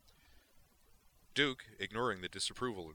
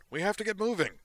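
much louder and higher, exasperated; demanding; 12 dB louder than usual. Acted. Duke demands why Zoner is still sitting, loud and exasperated. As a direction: bark the question at him.

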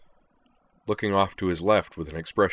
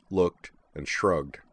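ordinary delivery

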